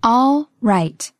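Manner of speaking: slow, drawn-out words; slow model. Shown as a demonstration. Teacher modelling a phrase for learners, slowly. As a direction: stretch and separate both words.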